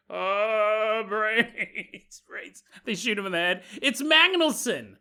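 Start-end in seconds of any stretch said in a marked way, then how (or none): 0.1-2.6 lightly voiced laughing zombie. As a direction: moan for brains like a zombie. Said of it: "zombie noises".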